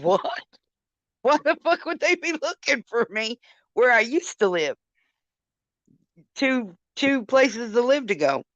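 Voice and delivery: high-pitched, incredulous questioning; retold disbelief. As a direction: demand an explanation, baffled and indignant. Retelling her disbelief at the news.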